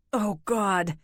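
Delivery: understated sore-throat rasp; scraped-raw throat, mildly voiced. A narrator's reading. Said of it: "he rasped".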